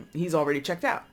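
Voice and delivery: usual speaking voice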